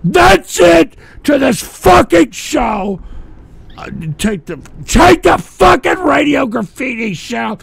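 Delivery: loud, angry yelling; furious shout. Real, not acted. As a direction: blow up and end the show. Shouting to end the show.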